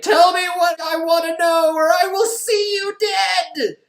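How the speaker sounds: over-the-top spooky yelling; intimidating shout. Retold or imitated. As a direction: shout like a hammy vengeful spirit to terrify him. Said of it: "Can you stop shouting and intimidating".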